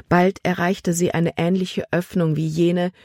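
plain reading voice

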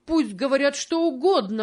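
high, pained cry of shame; a boy's outcry choked with sobs; crying out, holding back sobs. A narrator's reading. George cries out at Tom's sale, choking back sobs. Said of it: "кричал он со сдержанным рыданием".

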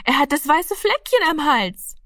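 put-on very high, excited child's cry; aufgeregter Ausruf. A narrator's reading. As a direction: cry out the discovery in a high, thrilled voice. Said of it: "schrie aufgeregt". (aufgeregt, mit ganz hoher Stimme)

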